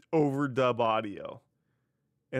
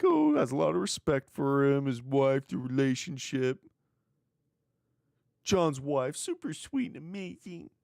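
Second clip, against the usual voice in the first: mock generic niceness; 2 semitones higher than usual. Host paraphrasing a wrestler's polite backstage answer, mock generic niceness. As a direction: imitate a canned, overly sweet answer. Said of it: "gave the same generic".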